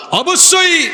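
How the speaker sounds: high, ringing declaration; emphatic affirmation. Retold or imitated. Preacher voices the Prophet's answer, high emphatic affirmation. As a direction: proclaim it with full conviction.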